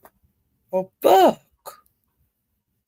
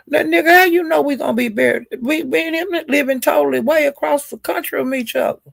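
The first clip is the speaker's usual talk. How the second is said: incredulous high-pitched scolding; outraged rant; 9 semitones higher than usual. Real, not acted. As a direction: shoot up high, indignant and fast. Ranting at Justin who named her.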